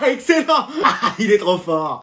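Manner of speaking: words through laughter; laughing approval. Acted. Classmate admiring the class clown, laughing approval. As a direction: crack up at the class joker.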